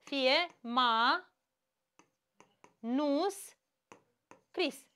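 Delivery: ma, nus, cris said as separate spaced syllables; split into syllables. Shown as a demonstration. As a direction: break Manuscris into slow beats. (ma-nus-cris, pe silabe)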